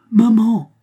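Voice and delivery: put-on a little child's voice crying out high and loud in terror; terrified child's call. A narrator's reading. Frightened child calling his mother from the other room, loud and high. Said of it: "une petite voix terrifiée appela".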